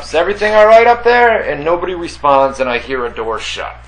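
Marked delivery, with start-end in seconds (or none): 0.0-1.4 called up the stairs loud and fast; yelled up. Retold or imitated. Neighbor yelling up to the third floor, loud and quick. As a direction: shout it up the stairwell. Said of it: "I yelled up".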